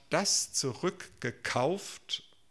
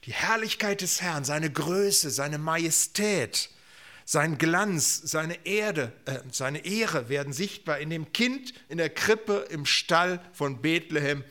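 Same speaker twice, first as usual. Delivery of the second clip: raised, weighty proclamation; emphatic preaching; 4 semitones higher, 4 dB louder than usual. Real, not acted. Preacher announces God's glory in the manger, emphatically. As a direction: build each noun as a proclamation.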